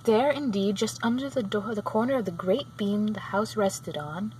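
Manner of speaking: ordinary delivery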